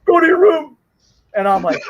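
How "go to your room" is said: sudden high, loud angry order; mimicking a furious father sending his kid away; angry dad. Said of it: "he's that mad".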